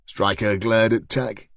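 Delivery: ordinary delivery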